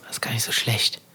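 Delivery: leise, gehauchte Gedankenstimme; flüstert den Gedanken vor sich hin; geflüstert. Retold or imitated. Gedanke beim Aufwachen. (geflüsterter Gedanke)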